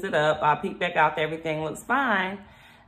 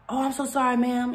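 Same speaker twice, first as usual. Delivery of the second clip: higher, lightly voiced sweet apology; apologetic worker; 7 semitones higher than usual.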